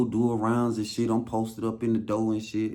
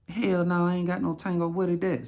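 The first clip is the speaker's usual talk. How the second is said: squeaky put-on voice of the older woman; high mock woman voice; 8 semitones higher than usual. Older coworker baffled by Tango, high mock voice. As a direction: play her baffled, pitched way up.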